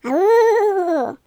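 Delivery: loud, high drawn-out ghostly moan; zombie moan. Shown as a demonstration. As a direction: moan like a mummy rising. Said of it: "mummy zombie moan".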